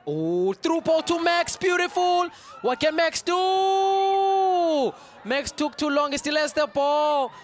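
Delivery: very high, loud, slow shouting commentary; excited yelling. Real, not acted. Commentator yelling as Max carries the ball forward, very high shouting. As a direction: scream the chance as it unfolds.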